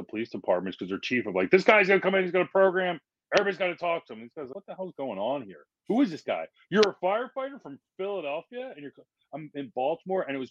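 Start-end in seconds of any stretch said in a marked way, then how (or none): 1.5-4.2 higher, louder, like a chief talking him up; hyped announcement. Retold or imitated. Chief announcing the visiting firefighter to cops, higher and louder. 4.5-9.1 higher, incredulous mock grumble; suspicious cops. Cops questioning the Philadelphia firefighter, lightly voiced incredulous grumble.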